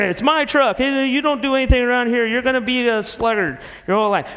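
mock teenage backtalk; sassy teen. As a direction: sass your dad as a teen. Acted quarrel, kid talking back.

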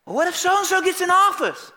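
high, hammed-up fretting; mock panic. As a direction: fret like a panicked follower, pitch way up. Mimics the panicking Christians.

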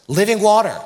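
"living water" hit high and loud; stress on living water. Shown as a demonstration. Pastor pointing his congregation to Jeremiah's words, "living water" stressed hard. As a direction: punch out "living water" as the key phrase.

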